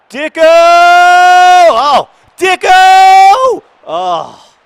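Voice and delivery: real goal-mouth scream of joy; excited shout. Real, not acted. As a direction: fan screaming at a near goal. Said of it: "so excited".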